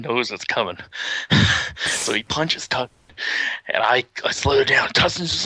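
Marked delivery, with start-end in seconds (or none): none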